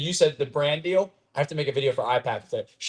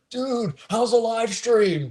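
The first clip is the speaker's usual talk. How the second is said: imitating a fan; 8 semitones higher, much slower than usual. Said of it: "four people come up to me".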